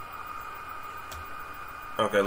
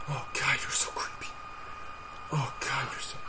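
half-whispered, uneasy aside; creeped-out hush; 10 dB quieter than usual. Real, not acted. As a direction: breathe it out softly, unnerved. Creeped out by the driver.

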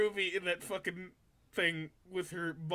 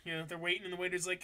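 ordinary talk

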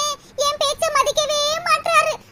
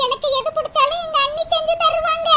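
ordinary talk

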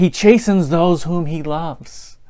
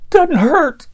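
higher, strained defiant voice; stubborn denial; 10 semitones higher than usual. Retold or imitated. The sister tells her dad it doesn't hurt while flinching, strained defiance. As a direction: insist through the sting. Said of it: "you could see her flinch".

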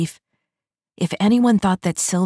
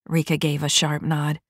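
usual speaking voice

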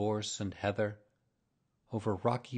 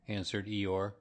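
usual speaking voice

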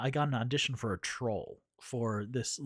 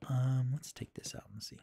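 soft whisper to herself; whispering while drawing; 3 dB quieter than usual. Real, not acted. Whispering over her drawing to stream chat.

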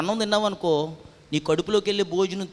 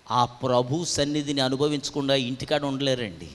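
ordinary talk, nothing marked

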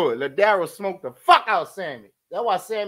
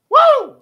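very high, loud woo; loud high whoop; 12 dB louder than usual. Real, not acted. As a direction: whoop with delight. Host whoops.

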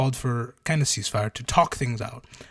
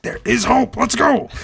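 high, loud mock cheer; excited discovery; 10 semitones higher, 7 dB louder than usual. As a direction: re-enact a jubilant rallying cry. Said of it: "that was me discovering".

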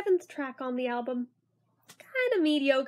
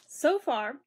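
ordinary delivery

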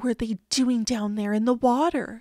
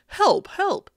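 higher, louder call for help; 8 semitones higher, 5 dB louder than usual. A narrator's reading. Policeman radios the firemen, urgent call. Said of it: "He called for the firemen".

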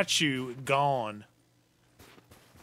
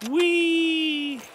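gleeful squeal; 14 semitones higher, 5 dB louder than usual. Real, not acted. Gleeful shout.

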